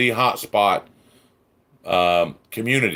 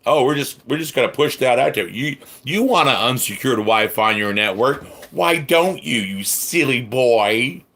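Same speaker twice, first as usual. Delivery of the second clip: higher, sarcastic imitation; mocking company voice; 4 semitones higher than usual. Mocks the company's attitude.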